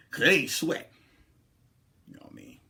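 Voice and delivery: usual speaking voice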